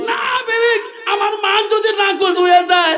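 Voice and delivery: very high, loud chanted cry; impassioned acted protest. Husband vows to his wife about mother's refusal, impassioned cry. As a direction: protest at full preaching pitch.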